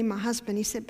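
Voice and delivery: ordinary delivery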